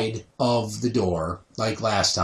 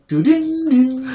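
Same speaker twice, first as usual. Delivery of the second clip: high sung voicemail ding-dong; sung phone chime; 12 semitones higher, 4 dB louder, slower than usual. Retold or imitated. Voicemail greeting starting, high sung chime. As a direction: sing the answering machine tone. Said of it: "you would hear".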